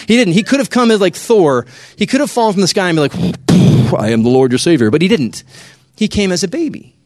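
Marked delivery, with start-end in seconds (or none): 4.0-4.9 low, loud heroic announcement; mock divine proclamation. Imagined Jesus falling from the sky like Thor, mock divine proclamation. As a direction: proclaim it like a god landing from the sky, hammed-up.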